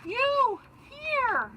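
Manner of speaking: very high, breathless astonished squeak; gasping in surprise. Acted. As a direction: gasp it out in shock at finding his wife. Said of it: "gasped Mister Bird".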